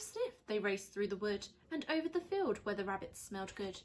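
usual speaking voice

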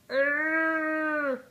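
high, loud cow bawl. Acted. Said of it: "bawled another".

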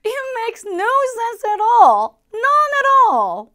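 loud, high, slow exasperated cry; slow, high exclamation of total disbelief; exasperated outburst. Acted. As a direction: throw up your hands in disbelief. Opening exasperated example line.

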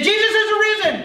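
high, loud cry of joy; excited shout. Acted. Disciples shouting to Thomas, thrilled and loud. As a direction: burst out with the good news.

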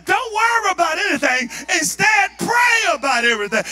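forceful preaching. Acted. Preaching the verse at full force.

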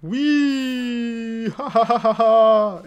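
high, loud burst of laughter; real gleeful cackling; delighted laugh. Real, not acted. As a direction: crack up with glee.

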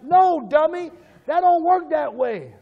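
high, loud, hammed-up telling-off; mock scolding. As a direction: scold the fool at full voice. Acted scolding of the seeker.

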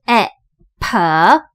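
slow, separated E, P, E, pen; sounding out short e. Shown as a demonstration. Teacher demonstrating the vowel, sounding out pen. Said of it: "第一个母音是E".

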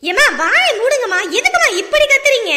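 loud scolding doctor; doctor yelling back. Acted. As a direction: shout her down, exasperated. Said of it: "இப்படி வாழு வாழுன்னு கத்திக்கிட்டு இருக்கா". (கோபமாக கத்தும் டாக்டர்)

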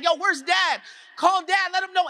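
high, urgent panicked calling; a frightened child shouting for his dad. Retold or imitated. Scared boy calling for his dad as he panics, high and urgent. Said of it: "I start to panic".

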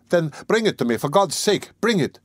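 high-pitched, urgent plea; excited cry. A narrator's reading. Lodger demanding his letter from the servant, excited cry. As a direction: cry out eagerly, pitch climbing. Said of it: "cried Raskolnikov, greatly excited".

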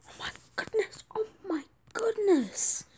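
half-whispered, awed excitement; breathless whisper climbing into a high voice; overjoyed disbelief. Real, not acted. Player reacts to the amazing trade, overjoyed disbelief.

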